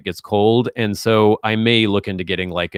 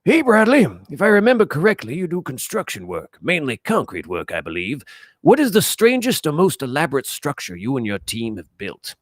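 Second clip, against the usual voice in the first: put-on much higher, far-off creaky old man; 5 semitones higher than usual. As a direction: play a doddering old man reading a question. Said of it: "in old man accent".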